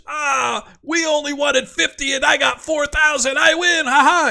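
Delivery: very high, excited giant yell; giant screaming in triumph. Acted. Giant celebrating four thousand gold with brothers, high screaming. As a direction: shriek your victory like a gloating giant. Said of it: "He starts screaming in Giantish".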